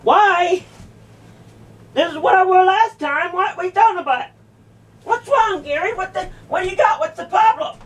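high-pitched, indignant questioning; indignant protest. Real, not acted. Confronted over wearing a dress.

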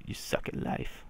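hushed, breathy put-down; whispered taunt. Acted. Dad whispering a taunt at his son. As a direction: whisper the insult close and mean.

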